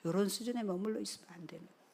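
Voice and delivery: soft, fast repeat of a warning; hushed admonishment. Real, not acted. Preacher letting the warning sink in with congregation, hushed admonishment.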